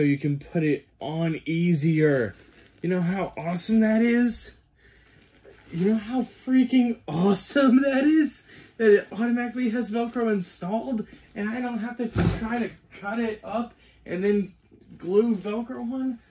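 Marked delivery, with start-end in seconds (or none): 2.8-4.6 high-pitched excited question; amazed and gushing. Real, not acted. Reviewer marvels to viewers at installed Velcro, excited. 5.7-16.2 high, giddy amazement; excited. Real, not acted. Reviewer thrilled he needn't glue Velcro on, excited. Marvelling at new velcro feature.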